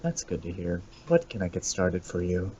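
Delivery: low, soft drive-thru mic voice; soft customer-service voice. Retold or imitated. Drive-thru worker taking an order, soft and low. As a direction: keep it close and quiet into the headset. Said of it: "I'm like".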